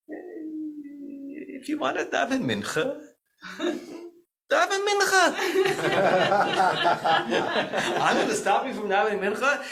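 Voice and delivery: put-on high, slow Polish-accented old rabbi, laughing through it; mock Polish accent, amused and dismissive; Rabbi Frankus Polish accent. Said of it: "in his beautiful Polish accent".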